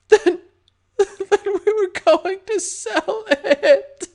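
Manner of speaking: high, very slow, sobbing; sobbing in fear. Acted. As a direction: blubber it out in terror. Terrified victim confesses.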